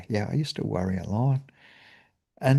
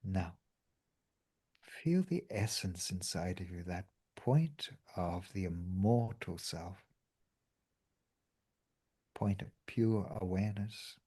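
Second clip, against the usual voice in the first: guided meditation; 8 dB quieter than usual. Acted. Leads a meditation.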